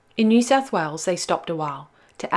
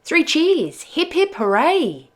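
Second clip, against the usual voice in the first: cheering; 10 semitones higher, 5 dB louder, slower than usual. Acted. Crew cheering in story.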